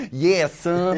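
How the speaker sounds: imitating an American co-host; playful American impression; mock American accent. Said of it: "That's me doing you".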